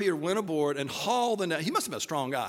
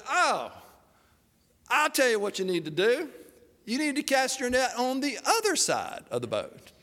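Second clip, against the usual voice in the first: know-it-all advice; 6 semitones higher than usual. Acted. Beach stranger giving professionals advice.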